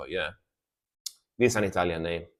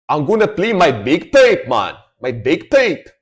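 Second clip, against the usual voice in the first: loud, high mock Scottish accent; 5 semitones higher, 7 dB louder than usual. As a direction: play a cheerful Scottish piper. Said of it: "I love that accent".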